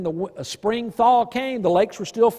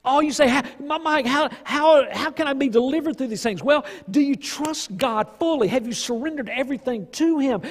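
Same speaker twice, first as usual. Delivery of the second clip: higher, urgent preacher voice; raised sermon delivery; impassioned preaching; 4 semitones higher than usual. Real, not acted. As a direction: keep the fire going.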